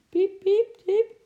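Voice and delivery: very high, loud repeated peeps; frightened squeak. Acted. Eki scared in the dark rattling van, squeaking. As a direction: squeak like a scared little squirrel. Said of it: "piepte Eki angstig".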